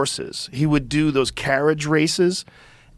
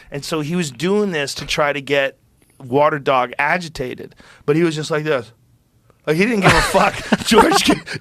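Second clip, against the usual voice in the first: ordinary talk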